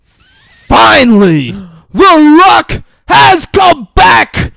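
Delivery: big drawn-out announcer shout; high, booming ring-announcer hype; hyped announcer. Acted. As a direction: belt it out slowly to a whole arena.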